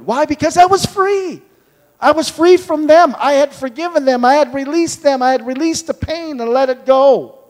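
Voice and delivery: high, fervent preaching of freedom. Acted. Preacher telling the church he forgave and was freed, fervent. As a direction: rise into a testimony of release.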